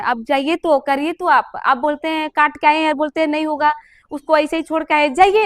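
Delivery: चिल्लाते हुए डॉक्टर से बहस, ज़ोर से और ऊँची आवाज़ में; ज़ोर देकर डॉक्टर को ललकारना; heated demand. Retold or imitated. Arguing with doctor, heated. (बेटी का डॉक्टर से ग़ुस्से में ज़ोरदार आग्रह)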